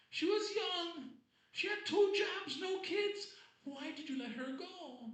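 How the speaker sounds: very high, slow, wailing melodrama; telenovela mother. Retold or imitated. Comic playing his mother in dramatic telenovela style over a swiped-left girl. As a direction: wail like a soap opera mom. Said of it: "she got super telenovela".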